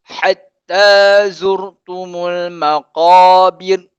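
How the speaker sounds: teacher reads the full verse for students, slow and chanted; full verse recitation. Shown as a demonstration. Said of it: "Ustaz baca ayat kedua yang lengkap".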